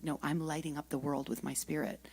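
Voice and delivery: soft whispered affirmation; hushed whisper, words barely voiced. Real, not acted. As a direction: whisper it like a secret truth.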